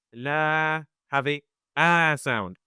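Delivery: slow "la" with a bright, front æ; light a. Shown as a demonstration. Teacher contrasting the light a of la. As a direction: stretch "la" with a light æ. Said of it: "for example".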